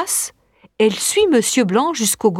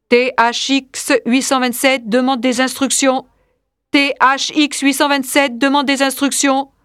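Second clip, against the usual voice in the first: monotone robot reporting, evenly spaced syllables; robotic android; 3 semitones higher than usual. Acted. Android asks Big Brother for instructions, robotic monotone. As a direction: report like a machine, all on one note.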